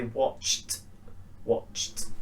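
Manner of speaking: "watched" ending in a voiceless t. Shown as a demonstration. Teacher modelling C H verbs, T ending. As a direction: say "watched" as one syllable, "watcht". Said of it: "t sound".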